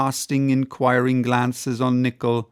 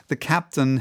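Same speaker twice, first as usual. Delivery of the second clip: ordinary delivery, nothing marked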